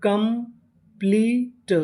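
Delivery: Complete very slowly broken into parts; syllable by syllable. Shown as a demonstration. Teacher assembles a word for learners, complete slowly syllable by syllable. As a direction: draw out complete piece by piece. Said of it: "तो meaning बनी".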